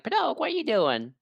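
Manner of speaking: high, put-on questioning voice; Jiminy. Jiminy checking on Pinocchio, high questioning voice. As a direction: ask it in a high character voice.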